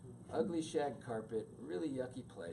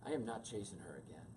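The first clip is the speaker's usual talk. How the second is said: softer, fast muttering; quiet muttered vow; 5 semitones lower, 7 dB quieter, faster than usual. Retold or imitated. Standing at the door, softly muttering to himself. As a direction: mutter it under your breath.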